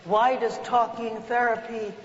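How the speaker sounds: usual speaking voice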